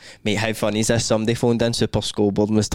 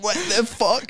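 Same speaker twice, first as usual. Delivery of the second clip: startled squeal; 9 semitones higher, slower than usual. Acted. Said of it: "You're like".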